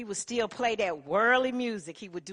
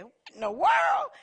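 much higher, loud exclamation; startled shout; 9 dB louder than usual. Retold or imitated. Denise startled by her name on the radio, shouting in surprise. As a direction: blurt it out in shock.

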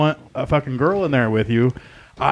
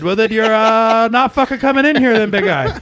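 much higher, louder retold yell; yelled refusal; 10 semitones higher, 6 dB louder than usual. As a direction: shout him down at the door. Telling off a customer at door.

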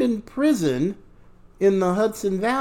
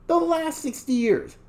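"The last sixty years" repeated higher; higher emphatic repeat; 6 semitones higher, 3 dB quieter than usual. Real, not acted. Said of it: "for at least the last sixty years".

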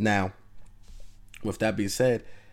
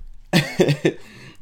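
embarrassed laugh; 7 semitones higher, 7 dB louder than usual. Real, not acted. Laughs at own word slip.

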